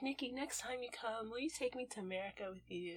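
very soft imitation of a child asking; small pleading request. Voicing little cousin asking to come to America, soft pleading. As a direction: ask softly, imitating a hopeful kid.

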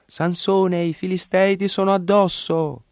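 calling out high, sharp warning; urgent high shout of alarm; high alarmed cry. A narrator's reading. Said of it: "Allora essa gli gridò".

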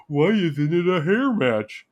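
slow, dopey mock voice; lightly voiced dumb fan; mock dumb question. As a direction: play himself as a dimwit. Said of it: "my dumb ass is like".

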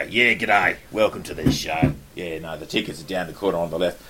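hammed-up Aussie ticket seller; mock broad Australian greeting; broad Australian accent. Door volunteer greeting American theatregoers, broad Australian accent. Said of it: "I'm not gonna go".

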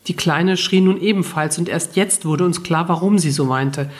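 usual speaking voice

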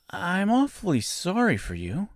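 put-on high, gentle girl's voice; mild girlish pity; sympathetic little girl. A narrator's reading. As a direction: console him in a light, high voice. Dorothy sympathizes with scarecrow.

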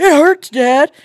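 high loud whiny cry; kids complaining. Retold or imitated. Sons complaining to dad, loud whine. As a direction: wail it like a hurt kid.

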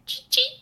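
high squeaky cheep; high chirp. Shown as a demonstration. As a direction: chirp like a tiny bird.

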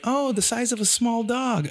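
high bright reassuring seller voice; lightly mocking an upbeat seller; chirpy seller. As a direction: sweetly promise it stays small. Quoting breeder's reassurance.

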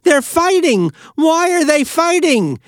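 high, loud alarmed yell; a mother yelling down the hall; mother's yell. Retold or imitated. Mother yelling at her fighting sons. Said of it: "and she yelled".

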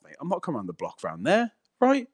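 usual speaking voice

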